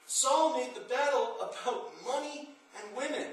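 rising preacher's fervor, pressing each line; preaching at full force. Acted. Preacher rousing the congregation, full force. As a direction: drive the story home to the congregation.

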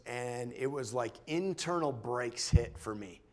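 normal talking voice

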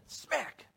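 sudden high-pitched "smack" sound word; imitated smack. Spouse smacking whoever raises a hand, sudden. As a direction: pop it out sharply like a slap.

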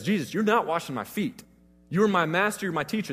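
firm refusal. Retold or imitated. Peter pulling his feet back from Jesus, mildly forceful refusal. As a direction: refuse him, a bit heated. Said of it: "almost now aggressively".

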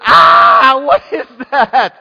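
high, very loud scream of alarm; terrified shout. Acted. Preacher playing frightened disciples in the boat, terrified shout. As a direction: shriek it in acted fright. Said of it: "These guys are terrified".

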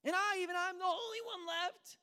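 high, loud self-pitying cry; frustrated complaint. Acted. Elijah telling God he alone remains, loud self-pitying protest. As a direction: wail it, aggrieved and alone. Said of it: "when we get frustrated with God".

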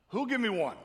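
high, loud bid call; auctioneer call. Shown as a demonstration. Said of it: "I am a licensed auctioneer".

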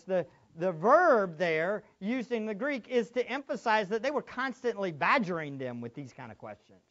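normal talking voice

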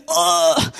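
exaggerated "ugh" of suffering; put-on aching groan; mock pained groan. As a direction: groan as if giving in to pain. Acted groan over pains.

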